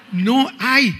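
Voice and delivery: high, loud burst of certainty; emphatic exclamation. Retold or imitated. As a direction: blurt it out with conviction. Exclaims, going to hell. (exclamación enfática)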